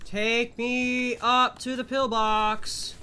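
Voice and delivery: pleading whine. Pretend. Pleading to the game.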